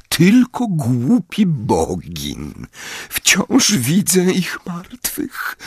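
slow, high, crying voice; tearful moan. Acted. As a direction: moan into his shoulder. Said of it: "jęknęła pani Wesley w jego ramię".

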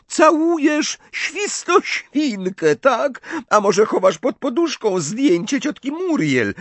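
very high-pitched jeering; howling mockery. A narrator's reading. Ginny jeers at Ron while dodging Harry, mocking. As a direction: taunt him at the top of your range. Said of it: "Ginny zawyła ze śmiechu".

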